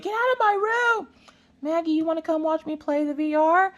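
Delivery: shrieked high "get out" then sugary high invite; mock the son's sudden switch from shoo to sweet; high, whiny kid voice, first rude then syrupy; bratty son flip-flopping.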